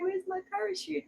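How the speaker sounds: high, soft, worried question; a small alarmed voice; lightly voiced sudden dread; mock panic. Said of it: "And you're like".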